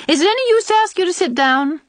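high, loud sarcastic question. A narrator's reading. Old aunt asking unwelcome visitors to sit, sarcastic. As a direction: make the invitation a pointed dig. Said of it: "she inquired sarcastically".

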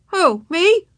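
high, loud startled question; surprised boy. A narrator's reading. Boy hailed by the teacher, surprised. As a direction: answer a call you didn't expect. Boy answers a sudden hail.

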